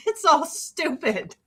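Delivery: ordinary delivery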